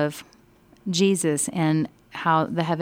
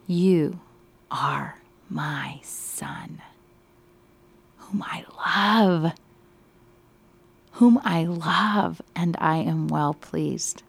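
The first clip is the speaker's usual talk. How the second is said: hushed awe; much slower than usual. Acted. Teacher voicing God the Father to the study group, slow and hushed. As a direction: whisper God's words in awe, slowly drawn out. Teacher voices God's words, awed.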